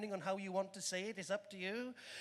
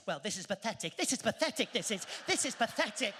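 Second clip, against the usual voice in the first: grumbling Brit; 4 semitones higher than usual. Retold or imitated. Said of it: "us British behind the Americans".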